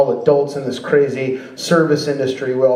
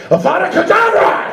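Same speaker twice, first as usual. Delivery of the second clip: high-pitched cry of a spell; shrill-pitched mock incantation; kid casting spell; 3 dB louder than usual. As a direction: yell it like a child wizard.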